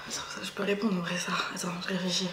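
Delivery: soft unvoiced whisper. Real, not acted. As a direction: whisper it to yourself, thinking. Whispers while thinking about answer.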